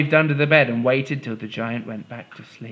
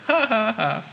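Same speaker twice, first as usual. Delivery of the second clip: giant's laugh; 8 semitones higher than usual. Acted. Giant laughing after threat.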